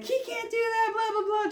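very high mock whine of a complainer; high-pitched blah-blah mimicry; mocking yammer. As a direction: mimic someone running their mouth, way up high. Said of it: "just running her fucking mouth".

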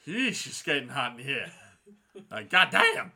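loud, high whooping reaction; excited whoop. Real, not acted. Reacting to saucy scene.

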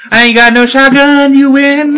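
loud, high put-on voice; hammed-up wail of surrender; beaten villain's wail. Preacher giving up to shotgun granny, loud mock wail.

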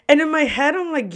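ordinary talk, nothing marked

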